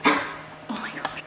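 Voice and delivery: breathy whispered oh my God; hushed exasperated whisper; whispered aside. Real, not acted. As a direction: whisper it under your breath. Aside while puppy struggles.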